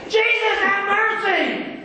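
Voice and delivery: high, loud, slow cry for mercy; the beggar keeps screaming at Jesus despite the crowd; desperate scream. Acted. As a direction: stretch out the plea and scream it. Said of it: "he just kept screaming".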